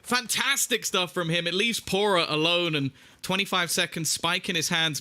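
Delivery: high-pitched esports caster at the climax; excited live commentary, stretched at the peak; excited play-by-play. Real, not acted. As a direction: call the big play, pitch soaring.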